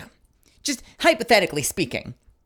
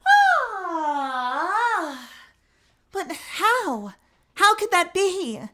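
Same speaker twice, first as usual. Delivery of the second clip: put-on softer high girl's voice; the softer retake of the witness's dismay; 11 semitones higher, much slower than usual. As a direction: let it come out gentle and dazed. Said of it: "where's my softness?".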